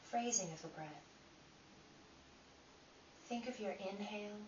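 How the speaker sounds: calm, unhurried guiding voice with long pauses; guided meditation. Acted. Teacher guiding listeners through breath inquiry, calm meditation voice. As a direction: lead them inward one gentle question at a time.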